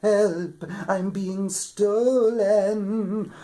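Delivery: high, loud, drawn-out cry; panicked harp calling out; harp crying for help. A narrator's reading. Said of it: "the harp cried out".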